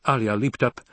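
ordinary delivery

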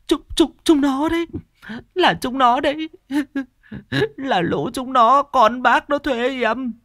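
put-on high, wailing old woman's plea at a grave; old woman sobbing prayer. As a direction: beg the dead man's forgiveness, tearful and high. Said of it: "mụ khấn". (giọng bà già khóc lóc khấn vái)

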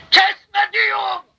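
high shouted cry of Kesme; high, loud warning cry; shouted command. Retold or imitated. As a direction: cry out to stop the sword. Voice cries out, stop.

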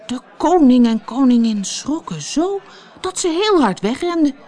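plain reading voice

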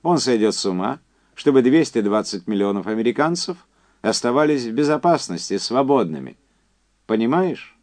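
high, loud, emphatic caller; Quincy's phone voice. Acted. Quincy telling Andy about the test survivors, high and insistent. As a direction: push it up high and project.

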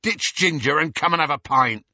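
put-on loud, leering drunk calling out; drunken yell. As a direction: shout across the road, tipsy. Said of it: "was yelling".

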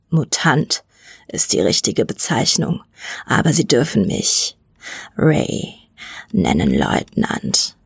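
very deep, slow rasping voice; deep monstrous rattle; rattling mutant. A narrator's reading. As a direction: growl it from the bottom of the chest. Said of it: "Seine Stimme klang röchelnd".

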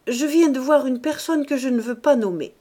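hint darkly at a secret; grave old lady. A narrator's reading.

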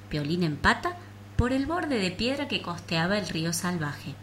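ordinary delivery

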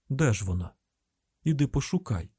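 ordinary delivery

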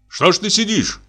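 high-pitched impatient demand; a mild shout, pitch jumping up; shouting at him. A narrator's reading. Hunter urges the sitting forester, impatient shout. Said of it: "крикнул охотник на лесника".

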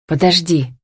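ordinary delivery, nothing marked

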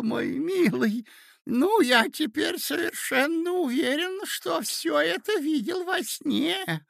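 put-on high, excited old man's voice; doddering old prince. A narrator's reading. Muddled old prince rambling to his nephew, high-pitched.

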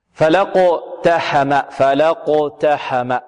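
very slow "فلقتحم", fa-la joined straight to the qaf; hamza dropped. Shown as a demonstration. Teacher models the joined reading, slow "فلقتحم" without hamza. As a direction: draw out "فلقتحم" syllable by syllable, with no glottal stop. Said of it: "هذه همزة وصل".

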